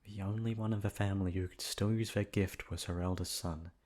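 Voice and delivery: ordinary delivery, nothing marked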